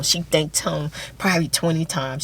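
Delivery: ordinary talk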